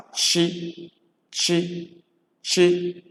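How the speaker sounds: "chi" held on a high, level tone; CH pronounced "chi". Shown as a demonstration. Igbo teacher demonstrating CH, "chi" sound. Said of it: "C H is pronounced as".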